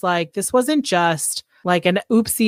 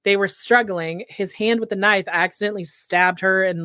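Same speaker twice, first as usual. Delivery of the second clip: normal talking voice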